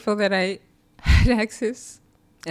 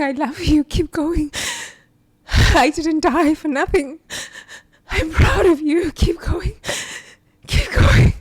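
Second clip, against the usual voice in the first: tearful dad's words; 7 semitones higher, 5 dB louder than usual. Real, not acted. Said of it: "my dad keeps saying".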